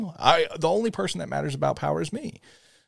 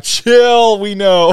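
high, loud drawn-out "chill"; loud mock exasperation; exasperated retort; 8 semitones higher, 10 dB louder, much slower than usual. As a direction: shut down the pestering fans loudly. Exasperated reply to fans.

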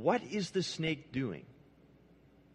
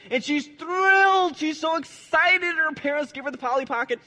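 much higher, louder, excited storytelling; thrilled girl; 11 semitones higher, 6 dB louder than usual. Acted. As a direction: bubble over with a child's joy.

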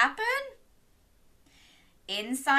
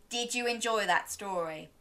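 ordinary delivery, nothing marked

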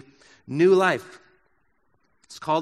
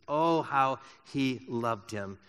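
tender; 4 semitones lower, 6 dB quieter, slower than usual. Acted. Preacher savouring Jesus's love for Lazarus.

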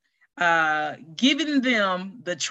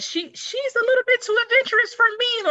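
much higher, squealing disbelief; exasperated exclaim; 3 dB louder than usual. Real, not acted. Aunt reacting to niece's cliff jumping plans, squealing high. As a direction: squeal it out, half horrified.

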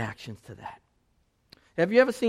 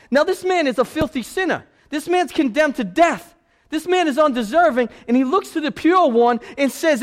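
louder, higher sermon delivery; fiery preaching; 11 semitones higher, 9 dB louder than usual. Acted. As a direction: preach it with full force. Preaching at full force.

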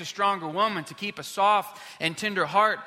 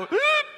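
sudden high yelp of a choked-back sob; a squeaky gulp bursting out in a silent cinema; loud sob yelp; 9 dB louder than usual. Retold or imitated. Retelling the church how he broke at Marley and Me, a sudden loud high yelp.

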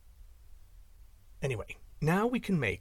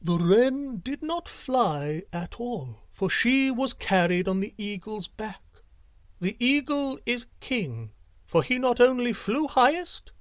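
wise owl's verdict; 9 semitones higher than usual. A narrator's reading. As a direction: deliver the judgement gravely. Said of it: "and then he said".

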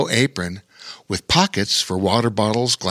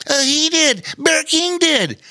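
gushing; 3 dB louder than usual. Acted. Otter tells gnome who freed him, gushing high. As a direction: bubble over pointing out Bear King. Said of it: "gushed Bobo Chico".

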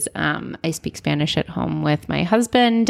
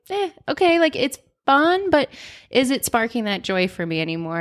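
high-pitched, unimpressed "eh"; bored shrug; 7 semitones higher than usual. Retold or imitated. To her running friend, mock unimpressed high "eh". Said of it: "it was kinda like".

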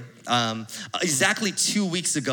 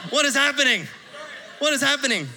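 incredulous outburst; 8 semitones higher than usual. Retold or imitated. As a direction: throw up your hands in disbelief. Disbelief at dentist bill.

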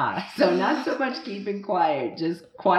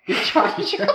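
high laughing repeat; amused echo; 5 dB louder than usual. Real, not acted. Host echoing a kid's answer, laughing in surprise. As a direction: crack up while repeating it.

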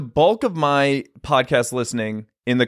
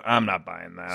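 deadpan skeptic; 9 semitones lower, 8 dB quieter than usual. Retold or imitated. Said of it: "I look at reality and I go".

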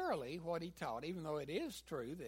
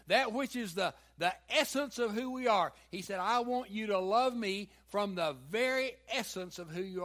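louder preaching; 4 semitones higher, 8 dB louder than usual. Real, not acted. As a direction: press the point home. Preaching with force.

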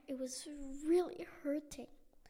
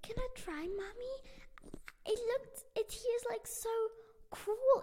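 high, excited begging; girl begging her mom to let her play soccer; eager pleading; 10 semitones higher, 4 dB louder than usual. Acted. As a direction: beg Mommy, bubbling over. Luna begs mom to play.

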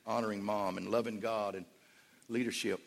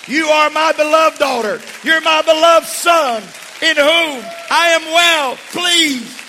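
loud, slow, booming proclamation; God blessing the newly baptized, booming loudly; 20 dB louder, slower than usual. Acted. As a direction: boom it out like God speaking from heaven. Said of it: "a voice that's gonna boom to them".

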